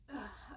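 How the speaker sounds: soft, heavy sigh; quiet give-up sigh; resigned sigh. Real, not acted. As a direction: let the breath go in defeat. Told she's going to jail.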